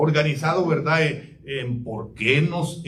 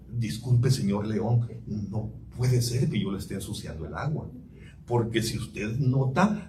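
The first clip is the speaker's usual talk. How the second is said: small, soft, timid reply, lower and softer; timid trembling deer; 6 semitones lower, 5 dB quieter than usual. Acted. Preacher voicing the scared deer answering the lion, soft and timid. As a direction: answer meekly like a frightened young deer. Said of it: "todo, eh, tembloroso".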